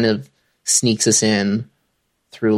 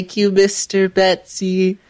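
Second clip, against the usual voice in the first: put-on kid voice; 9 semitones higher than usual. Kid politely thanks dad.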